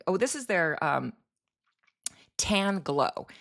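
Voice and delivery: ordinary delivery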